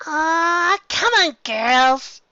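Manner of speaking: high, slow, sing-song chirp; high chirpy coaxing. Acted. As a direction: cheer up the gloomy friends. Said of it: "Pinkie Pie chirped".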